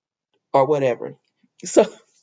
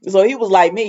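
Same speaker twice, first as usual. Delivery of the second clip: ordinary talk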